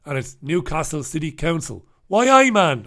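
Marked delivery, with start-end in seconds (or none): 2.1-2.8 bad Geordie accent, louder; failed Geordie impression. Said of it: "I can't do any fucking accent".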